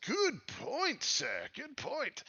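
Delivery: high, swooping character voice; conceding little character. Acted. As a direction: concede the point in a high put-on voice. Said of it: "he says".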